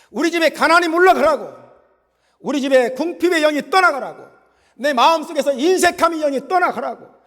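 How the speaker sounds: high, forceful casting-out shout; commanding proclamation. Shown as a demonstration. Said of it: "막 선포해야 돼요". (소리 높여 명령하듯 선포)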